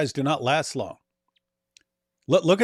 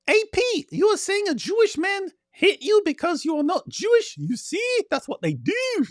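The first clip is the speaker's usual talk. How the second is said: very high squeaky puppet voice, slowing at the end with a laugh; high cartoon character voice with a giggle; Farfour puppet voice. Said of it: "Farfour says".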